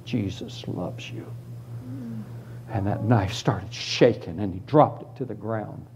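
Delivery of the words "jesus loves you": very soft, low and slow; gentle, hushed reply to a threat; tender. Retold or imitated. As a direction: say it softly, slowly, with quiet love. Boy answers knife threat tenderly.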